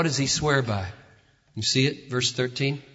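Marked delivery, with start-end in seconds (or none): none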